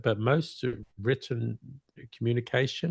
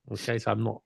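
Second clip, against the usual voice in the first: normal talking voice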